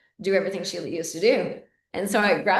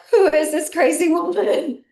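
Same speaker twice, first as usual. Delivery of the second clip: put-on higher, louder startled child voice; imitating the kids; 9 semitones higher, 6 dB louder than usual. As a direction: kids baffled and scared of grandma. Kids asking about grandma.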